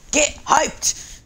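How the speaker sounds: high, loud hype yell; hyped shout. Real, not acted. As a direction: burst out loud to fire everyone up. Hyping up self and viewers.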